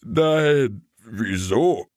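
drowsy dog voice, a touch surprised; sleepy dog. Acted. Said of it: "Bruno gähnte".

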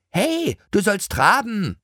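put-on impatient girl. A narrator's reading. Girl urges pony. (ungeduldiges Mädchen, höher, langsam)

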